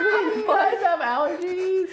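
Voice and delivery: very slow, high, laughing. Real, not acted. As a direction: stretch it out laughing. Laughing question.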